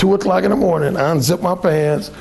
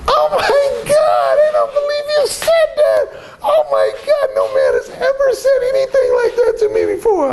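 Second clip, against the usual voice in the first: shocked woman. Retold or imitated. Woman reacting to his blunt come-on, hammed-up shock. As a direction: imitate her scandalized high-pitched gasping outburst.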